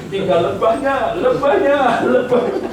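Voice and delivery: mock bee sting. Joke about bees after honeymoon.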